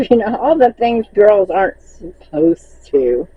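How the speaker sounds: ordinary talk, nothing marked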